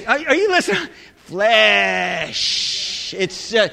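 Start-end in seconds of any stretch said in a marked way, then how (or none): 1.2-3.1 Flesh in a low voice, stretched very long; long, low "Fleeesh"; drawn-out FLESH. Shown as a demonstration. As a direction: drag out Flesh, holding the vowel and the final sh. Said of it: "Say it like this".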